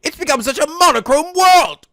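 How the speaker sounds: loud theatrical wail, high and anguished; dramatic outcry. Acted. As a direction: cry it to the heavens like a tragic hero. Holmes dramatic lament read aloud.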